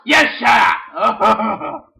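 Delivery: slow, loud drawn-out yell; kid's play-fight comeback, slow and loud; angry shout. Pretend. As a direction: stretch the shout out in defiance. Toy quarrel reply.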